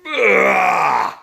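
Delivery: loud, high ugh of revulsion; a giant's retching roar; disgusted roar. A narrator's reading. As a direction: bellow in disgust. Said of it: "roared the blood bottler".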